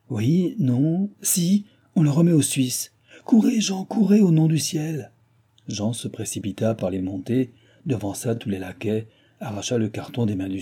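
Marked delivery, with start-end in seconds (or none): 0.1-5.1 high, loud, breathless excitement; urgent cries from the window; frantic urging. A narrator's reading. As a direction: shout orders to hurry. Acted frantic watch at the window.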